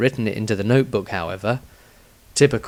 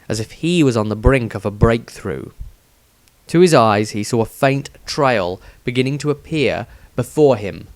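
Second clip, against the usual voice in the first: plain reading voice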